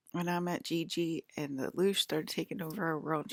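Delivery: ordinary talk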